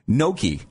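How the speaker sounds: Gnocchi said NYOH-kee; careful correct pronunciation. Shown as a demonstration. Host reads the next word, Gnocchi as nyoh-kee. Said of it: "try to pronounce them correctly".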